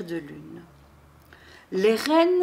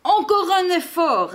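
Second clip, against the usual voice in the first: high, louder storybook urging; insistent; 8 semitones higher, 5 dB louder than usual. A narrator's reading. Santa urging the tired reindeer on, insistent. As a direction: push them for one more try. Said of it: "insiste le Père Noël".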